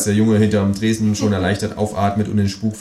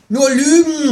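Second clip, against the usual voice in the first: much higher, louder indignant cry; outraged cry; 6 dB louder, slower than usual. Acted. Kangaroo outraged at the narrator's radio stories, loud and much higher. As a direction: shout it in outrage. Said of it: "ruft das Känguru empört".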